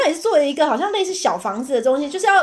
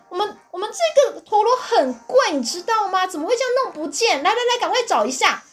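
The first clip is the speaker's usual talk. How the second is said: pushy parent; 7 semitones higher than usual. Retold or imitated. Parent demands the teacher find the lost top, high and pushy. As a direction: lean on the teacher.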